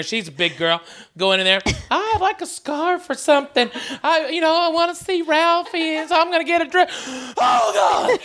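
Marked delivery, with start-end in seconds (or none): 1.9-6.9 raised, breezy voice of the mom out shopping; mock the big girl chirping at the store clerk; imitating her mom. Host imitating the storyteller's mom, raised and breezy. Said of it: "like my mom going in there". 7.4-8.2 mock gasp of disgust. Host recoiling from the imagined dressing-room stench, yelping oh. Stench hits him in store.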